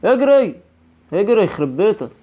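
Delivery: loud high yell to run; panicked shout. Real, not acted. Gamer yelling at his character to flee, panicked. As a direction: bark at yourself to run.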